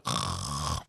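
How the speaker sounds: hammed-up mumbling speech that loses its thread; Joe Biden. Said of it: "That is my impression of, uh, of Joe Biden".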